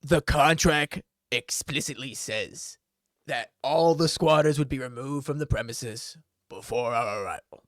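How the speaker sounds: stern LeBron reading the rules. Acted. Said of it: "a very stern".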